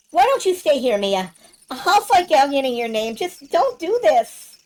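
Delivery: high-pitched, urgent pleading of a worried friend; Nash. Acted. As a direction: beg them to stay, pitch held high. Player voices Nash pleading.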